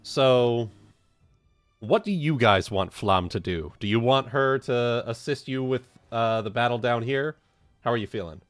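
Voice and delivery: usual speaking voice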